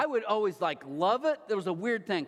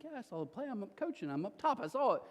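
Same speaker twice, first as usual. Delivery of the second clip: higher, brushing-off reply; a light imitation of a distracted coach dad; husband shrugs off wife's question, mildly impatient; mock impatient; 7 semitones higher, 3 dB quieter than usual. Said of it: "I'm like".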